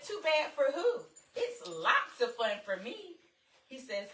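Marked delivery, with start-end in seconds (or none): 0.0-3.0 high, loud sassy retort; loud playful sass; sassy comeback. Retold or imitated. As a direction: snap it back with sass. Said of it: "I said".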